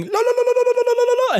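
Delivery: very high, loud babbled war cry; mock yelling. Player mocks how the dwarf came out, high yelling. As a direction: rattle off shrieked la-la-las, imitating her. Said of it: "She came out yelling".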